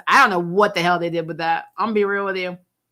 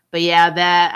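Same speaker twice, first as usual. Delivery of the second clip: ordinary talk, nothing marked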